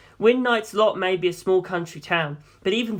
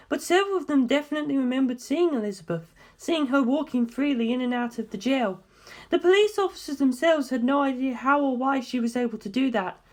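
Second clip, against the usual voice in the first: put-on young woman, higher than the narration, steady and serious; Darlington's voice; 9 semitones higher than usual. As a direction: lay out the findings calmly, like a briefing. Darlington continues, teasing George.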